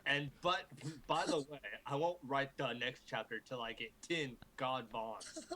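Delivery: ordinary talk, nothing marked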